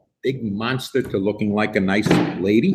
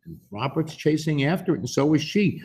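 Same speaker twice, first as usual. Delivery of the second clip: plain reading voice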